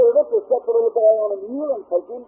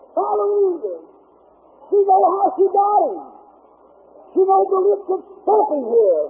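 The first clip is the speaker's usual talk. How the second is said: higher, building preacher's cadence with long pauses; rising preaching; 4 semitones higher than usual. Acted.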